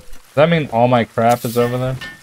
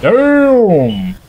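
much higher, louder exclaim; excited exclamation; 11 semitones higher, 6 dB louder than usual. Real, not acted. Player amazed by a one-swing kill, excited shout. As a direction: burst out impressed.

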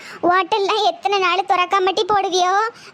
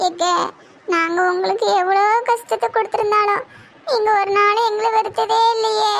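tearful pleading; 3 semitones higher than usual. Acted. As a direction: voice pitched up and strained with tears. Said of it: "அழுவாதியாட்டி".

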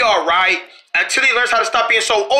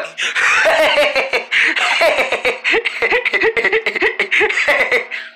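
high giggling fit; 6 semitones higher than usual. Acted. Long laughing fit.

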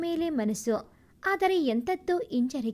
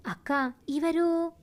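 curious. Acted. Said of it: "Ajay ಕುತೂಹಲದಿಂದ ಕೇಳಿದ".

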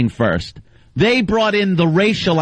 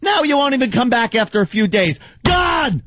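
triumphant shout; 8 semitones higher than usual. Real, not acted. Banning caller, triumphant.

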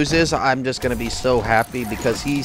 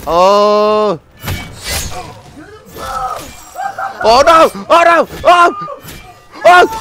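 excited shouting; 11 semitones higher, 6 dB louder, slower than usual. Real, not acted. Reacting to a close fight.

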